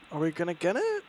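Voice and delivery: ordinary delivery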